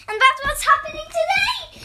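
high squeal with a laugh; bursting with excitement; excited laughing. Real, not acted. Squealing about new story.

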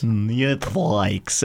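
"Neat Flags" in a heavy fake Russian accent; mock Russian accent. Friend repeating the bad Russian Netflix gag. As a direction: mimic the Russian attempt. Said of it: "an attempt at Russian".

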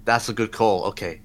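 normal talking voice

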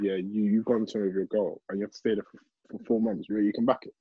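normal talking voice

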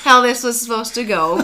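high, loud, through laughter; laughing. Real, not acted. Wife recalling to her spouse how it went, laughing. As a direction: burst out laughing as you speak.

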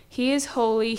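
ordinary talk, nothing marked

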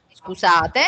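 usual speaking voice

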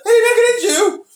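very high, loud exclamation; mock outrage. Realising the star was rude to them, mock outrage. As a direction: squeal it in hammed-up shock. Acts out realisation of being insulted.

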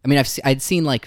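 ordinary talk

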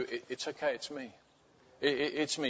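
very soft reassurance; comforting. Acted. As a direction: gently tell them they are safe. Jesus comforting the believer.

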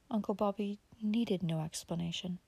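ordinary delivery, nothing marked

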